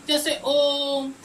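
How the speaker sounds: ओम held long, the ओ stretched; drawn-out Om. Shown as a demonstration. Teacher showing pupils how ओम is drawn out. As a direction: stretch ओम out as a pluta vowel. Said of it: "उसके sense को खींचोगे आगे की तरफ".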